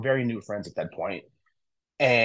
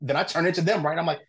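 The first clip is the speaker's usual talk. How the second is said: usual speaking voice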